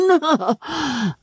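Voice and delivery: high chuckle after the call; a plump man's merry laugh; jolly laugh. Acted. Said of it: "a voice cried behind him".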